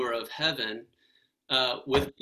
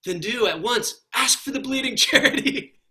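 higher, louder, breaking into a laugh; laughing quote; 7 semitones higher, 6 dB louder than usual. Retold or imitated. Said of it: "And he says".

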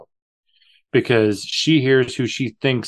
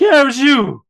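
accusing shout; 13 semitones higher, 7 dB louder than usual. Retold or imitated. Friend accusing her in retelling.

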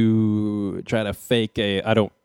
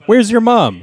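higher, louder, demanding where she is; mock indignant shout; 11 semitones higher, 7 dB louder than usual. A mock Carl asks Richie where his mom is, with an indignant shout. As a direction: sells the mock outrage.

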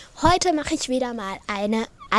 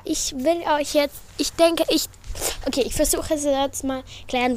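normal talking voice

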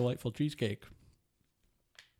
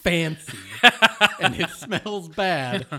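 louder, higher, laughing fancy chef; 4 semitones higher, 8 dB louder than usual. Retold or imitated. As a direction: ham up a pretentious chef. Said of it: "somebody was like".

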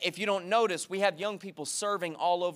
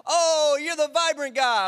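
higher, louder eager "Oh, you're the Vibrant guy"; excited recognition; 6 semitones higher, 5 dB louder than usual. Retold or imitated. Stranger in a coffee shop recognizing the pastor, excited. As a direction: imitate a stranger thrilled to spot you. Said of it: "and he said".

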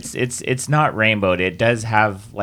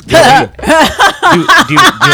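laughing; 9 dB louder, much slower than usual. Real, not acted. Laughing offer.

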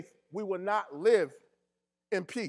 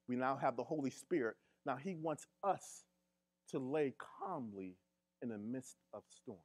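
softer, lower, intimate sermon voice; quiet preaching; 3 semitones lower, 7 dB quieter than usual. Acted. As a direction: draw them in with a hushed tone. Quiet reflective stretch of sermon.

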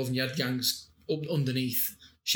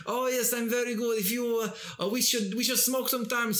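higher put-on friend voice; Stefan voice; 8 semitones higher than usual. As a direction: answer as Stefan, pitched up. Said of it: "not only did he do the voices".

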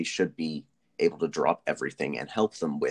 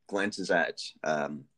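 ordinary talk, nothing marked

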